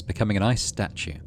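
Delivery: ordinary delivery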